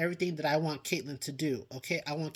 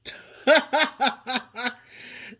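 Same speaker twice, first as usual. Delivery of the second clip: real laugh; 7 semitones higher, 5 dB louder than usual. Real, not acted. Laughing at her roller coaster joke.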